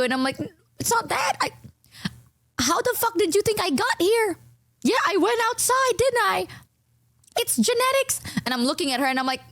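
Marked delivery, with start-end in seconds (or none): 0.8-8.2 high, sassy, disbelieving retort; exasperated comeback. Retold or imitated. Snapping back at the makeup lady, high and sassy. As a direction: fire it back, pitched way up.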